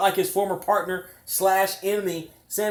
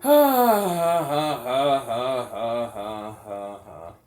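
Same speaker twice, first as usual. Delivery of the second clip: fading laugh; 8 semitones lower, much slower than usual. Real, not acted. Laughter trailing off after commentary.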